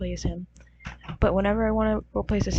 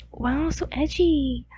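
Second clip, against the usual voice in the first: mock impressed; 5 semitones higher, 3 dB louder, slower than usual. Mocking her own character description.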